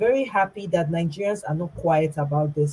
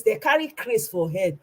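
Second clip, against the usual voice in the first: ordinary talk, nothing marked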